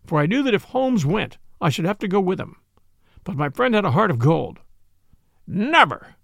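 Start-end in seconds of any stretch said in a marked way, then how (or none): 5.5-6.2 fierce cry. A narrator's reading. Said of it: "he cried fiercely".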